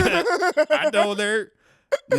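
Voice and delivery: put-on very slow Southern-accented punchline; Chuckleberry Finn. Comic replaying his punchline for laughs, slow Southern accent. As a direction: stretch it out in Southern, pause before the last word. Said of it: "saying them in a Southern accent".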